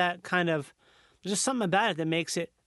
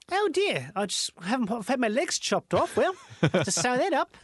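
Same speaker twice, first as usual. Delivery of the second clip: higher, cheerful Kiwi understatement about lost legs; pleasant New Zealander; 4 semitones higher than usual. Acted. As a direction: chirp it like the nicest Kiwi alive. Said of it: "most pleasant person who is".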